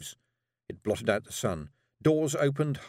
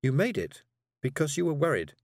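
put-on higher, knowing girl; 6 semitones higher than usual. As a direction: say it plainly like a child. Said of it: "said Tiffany".

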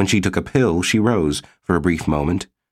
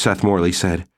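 ordinary delivery

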